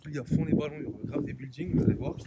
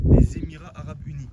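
loud emphasis; 3 semitones higher, 8 dB louder than usual. Real, not acted. Repeats the country name, louder.

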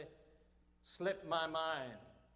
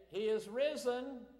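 greeting call; 6 semitones higher, 6 dB louder than usual. Retold or imitated. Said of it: "they say".

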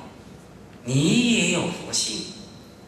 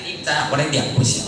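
ordinary delivery

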